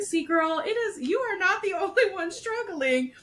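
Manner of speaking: high, giggly pep talk to herself; high laughing reassurance to herself on the rocks; laughing self-reassurance. Retold or imitated. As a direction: laugh it off, girl.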